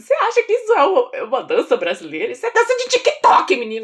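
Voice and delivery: hammed-up exasperated telling-off; mock indignation. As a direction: scold him in exaggerated disbelief. Mock-indignant retort to the boy.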